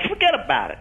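"Forget about it" in Irish American accent, tune rising; Irish American lilt, playful. Shown as a demonstration. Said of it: "the Irish all goes up ... You'd go".

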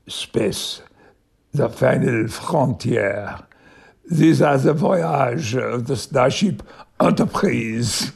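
slow, hammed-up French accent; English with heavy French vowels and r's. Shown as a demonstration. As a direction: intone it grandly in a thick French accent. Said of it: "my French accent ... I did that".

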